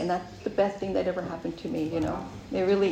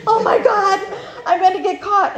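high, loud panicked gasp of fear; retelling a panic as cop lights appear behind her; 11 semitones higher, 8 dB louder than usual. Retold or imitated. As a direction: blurt it out in a sudden panic, laughing. Paranoid at seeing cop lights.

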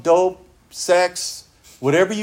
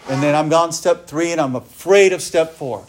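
ordinary talk, nothing marked

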